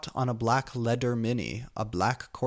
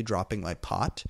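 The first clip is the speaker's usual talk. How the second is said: ordinary delivery, nothing marked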